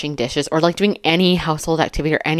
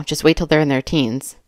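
normal talking voice

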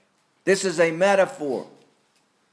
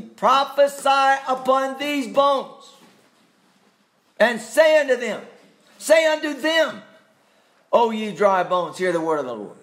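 preaching at full force; 10 semitones higher, 4 dB louder than usual. Shown as a demonstration. Preacher rereads the verse to his congregation, preaching at full force. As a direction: cry it out like a prophet over the bones. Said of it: "look at it again".

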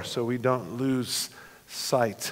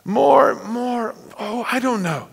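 put-on higher, breathless girl's voice full of wonder; wondering Lucy; 9 semitones higher, 7 dB louder than usual. Lucy gazing at the new hills, high and amazed. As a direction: marvel like a young girl seeing home. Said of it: "Lucy says".

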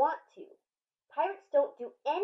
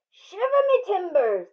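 higher, louder salty pirate; 7 semitones higher, 6 dB louder than usual. Acted. Head pirate exclaims to the boy, loud and high. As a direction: exclaim like an old sea dog. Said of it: "the pirate said".

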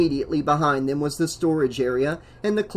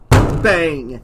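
loud, punched, falling blow; exclaimed bang; 8 dB louder than usual. A narrator's reading. Old man's cane hits the floor, loud bang. Narrator voices the cane blows.

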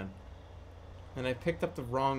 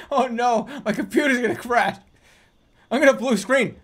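loud, high alarmed yelling; real alarm at a freezing computer; panicked shouting; 6 semitones higher, 11 dB louder than usual. Real, not acted.

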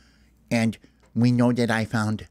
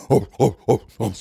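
loud barking dog "oh, oh, oh"; 6 dB louder than usual. Retold or imitated. Imitating the two dogs barking loudly. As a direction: bark out the dog noises. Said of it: "They just go".

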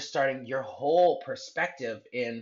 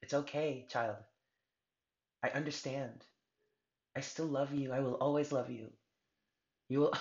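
soft, tender parental reassurance; gentle comfort, close to tears; God comforting a child; 5 dB quieter than usual. Acted. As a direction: speak softly, full of love. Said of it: "God is also saying that".

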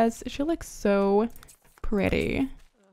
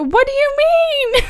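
high, loud what-do-you-mean outcry; baffled squeal at the screen; shocked disbelief; 13 semitones higher, 6 dB louder than usual. Real, not acted. Player shrieking at her upset Sim, high and loud. Sims guest suddenly upset.

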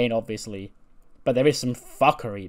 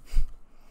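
short laugh; 7 dB louder than usual. Real, not acted. Laughing at his game's booking.

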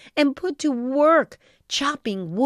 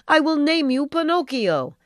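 weeping with joy; 6 semitones higher, 3 dB louder than usual. A narrator's reading. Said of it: "Geppetto wept with joy".

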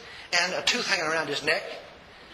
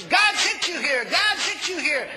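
excited retold plea; 9 semitones higher, slower than usual. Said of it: "He says".

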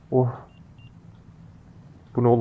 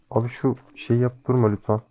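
ordinary delivery

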